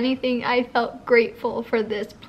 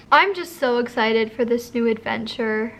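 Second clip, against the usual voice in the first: ordinary talk, nothing marked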